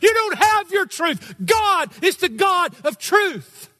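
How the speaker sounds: high, loud, slow preaching shout; full-voice pulpit shout. Real, not acted. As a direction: thunder it at the back of the balcony. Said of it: "you didn't preach that with a gentle tone and tenor".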